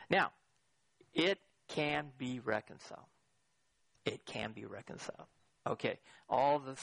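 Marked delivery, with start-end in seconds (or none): 1.1-3.0 deliberate declaration. Real, not acted. Deliberate claim, then softer repeat. 4.0-5.3 much softer and lower, quicker run of words; hushed repeat. Real, not acted. Preacher repeating his answer to the congregation, hushed.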